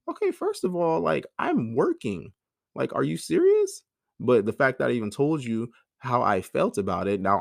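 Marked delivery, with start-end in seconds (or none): 0.1-3.8 exasperated disbelief. Retold or imitated. Exasperated retort to partner's accusation.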